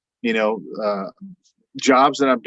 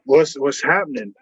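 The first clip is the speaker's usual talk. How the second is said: confused surprise; 5 semitones higher than usual. Retold or imitated. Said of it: "And I'm like".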